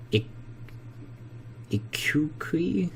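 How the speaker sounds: Iquique whispered slowly with a questioning rise; half-whispered unsure try at Iquique. Real, not acted. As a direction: breathe Iquique out as a doubtful guess. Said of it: "stop trying to pronounce those names".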